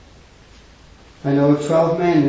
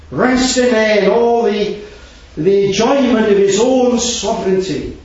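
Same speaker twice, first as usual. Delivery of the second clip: impassioned preaching; 7 semitones higher, 4 dB louder than usual. Acted. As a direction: pour out the believer's complaint with rising fervour. Preacher voicing the troubled believer.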